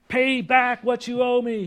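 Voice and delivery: loud, high and slow, a furious servant demanding his money; angry demand. Acted. Servant choking his fellow servant over twenty bucks, furious shout.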